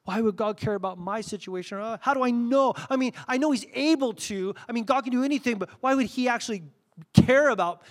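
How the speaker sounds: high-pitched, fretful questioning; mock-anxious objection; acted doubting. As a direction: voice a doubter's protest, rising in pitch. Acts out a doubting believer's questions.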